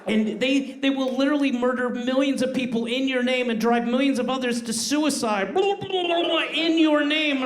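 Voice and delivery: a parent's growing fury at the fans; rising outrage. Acted. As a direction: let the indignation swell toward the last words.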